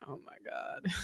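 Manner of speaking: breathless whisper; whispered oh my God. Real, not acted. Host reacting to the clip, whispered. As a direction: whisper it in disbelief.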